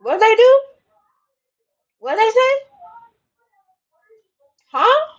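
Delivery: high, loud mock-baffled what they do, huh; incredulous. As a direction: demand it in mock disbelief. Incredulous questioning of gossip.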